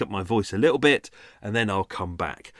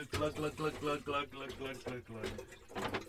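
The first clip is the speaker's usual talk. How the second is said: playful glug sounds for pouring water; sing-song glug glug from afar; mock glugging noise; 2 semitones higher, 15 dB quieter, slower than usual. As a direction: voice the water filling the kettle. Imitating kettle being filled.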